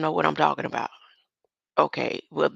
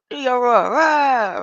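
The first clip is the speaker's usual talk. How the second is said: loud, higher coaxing with a mock roar; 7 semitones higher, 9 dB louder than usual. Grandma urging her grandson to roar, loud and coaxing. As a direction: egg him on loudly. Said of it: "I said".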